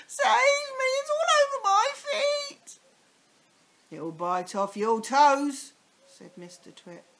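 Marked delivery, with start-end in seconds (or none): 0.1-2.8 very high, loud terrified wail; panicked crying. Acted. Mrs Twit panicking. 3.9-5.7 slow, loud menacing tease; spooky gloating husband. Acted. Mr Twit gloating threat.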